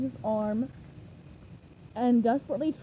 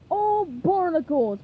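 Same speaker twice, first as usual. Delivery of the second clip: loud, slow frustrated curse; cursing; 5 semitones higher, 7 dB louder, slower than usual. A narrator's reading. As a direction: spit it out in annoyance. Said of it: "Plankton cursed".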